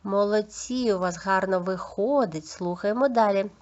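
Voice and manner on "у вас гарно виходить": ordinary delivery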